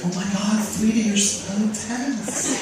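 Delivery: lightly voiced doting hairdresser; soothing stylist. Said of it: "He's like".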